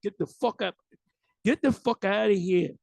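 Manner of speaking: lightly put-on boxer voice; a hint of an impression, ordering people out; Canelo. Preacher dismisses questioners in GMS, in a light Canelo impression. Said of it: "Canelo voice".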